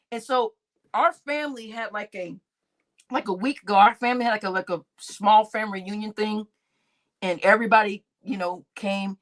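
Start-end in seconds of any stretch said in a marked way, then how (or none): none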